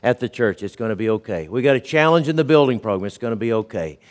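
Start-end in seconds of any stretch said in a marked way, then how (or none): 0.6-1.5 calm reassurance. Retold or imitated. Pastor voicing his friend's calm refrain, softly reassuring. 3.1-4.0 calm reassurance. Retold or imitated. Pastor voicing his friend's calm refrain, softly reassuring.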